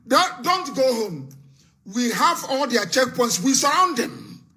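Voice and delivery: high-pitched, insistent rallying orders; rousing rally cry. Real, not acted. Host orders followers not to go home, rousing rally cry. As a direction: urge them on, voice pitched right up.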